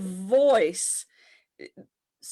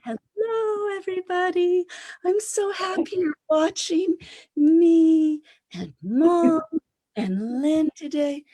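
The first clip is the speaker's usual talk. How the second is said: put-on higher, sing-song, like talking to a child; lilting, high sing-song hello; sing-songy earth voice; 8 semitones higher, 5 dB louder than usual. Shown as a demonstration. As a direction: coo a greeting like to a little kid. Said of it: "the earth is really sing-songy".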